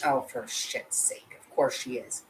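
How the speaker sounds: slow, breathy, soft exasperated sigh of words; barely voiced, weary disgust; exasperated mutter. Real, not acted. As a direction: mutter it under your breath, fed up. Exasperated aside about the mother.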